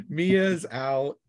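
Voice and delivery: ordinary delivery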